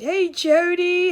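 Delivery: ordinary talk, nothing marked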